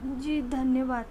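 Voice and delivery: quiet, timid thanks; a maid murmurs thanks with a hint of trembling. A narrator's reading. Maid thanks her master, quietly trembling. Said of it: "कांपते ही स्वर में".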